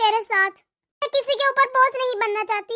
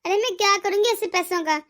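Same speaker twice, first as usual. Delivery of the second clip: tender comfort; 5 semitones lower than usual. Acted. Mother comforts her daughter-in-law. (बहू को दिलासा देती माँ, बहुत नीची, धीमी और कोमल आवाज़)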